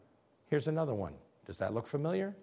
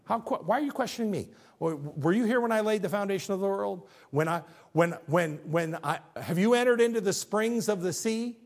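God answering Job; 6 semitones higher, 3 dB louder than usual. Acted. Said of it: "So God answers him, said".

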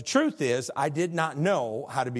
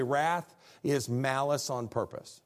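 normal talking voice

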